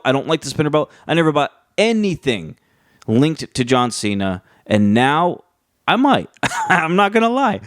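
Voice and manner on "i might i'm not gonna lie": higher, laughing admission; sheepish laugh. Real, not acted. Admits he might buy merch.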